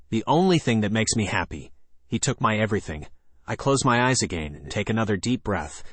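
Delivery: ordinary delivery, nothing marked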